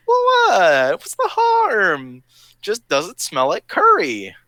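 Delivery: high, drawn-out mock "what's the harm"; exaggerated, high-voiced innocence; mock innocent protest. Retold protest to shocked friends.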